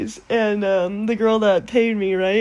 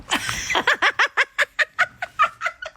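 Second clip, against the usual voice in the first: high, drawn-out giggle; real high cackle, trailing on; mischievous cackle. Real, not acted. Trickster relishing the job of ruining a wedding, wicked cackle. Delights in being hired for mischief.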